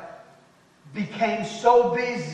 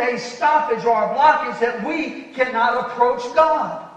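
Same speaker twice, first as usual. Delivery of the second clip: loud, high-pitched pulpit delivery; full-force sermon voice, raised and urgent; preaching; 7 semitones higher, 9 dB louder than usual. Real, not acted. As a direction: preach it hard to the congregation.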